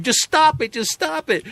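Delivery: high, insistent mock chant; Nancy Pelosi. Politician chanting back at activists, high mock yell. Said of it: "She starts chanting back at them".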